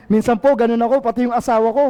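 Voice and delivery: ordinary talk, nothing marked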